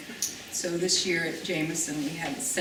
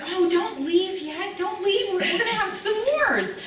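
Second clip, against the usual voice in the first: much higher, drawn out eager calling; eager plea; 14 semitones higher than usual. Retold or imitated. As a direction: sing it out to stop the guests leaving. Hostess calling guests to stay.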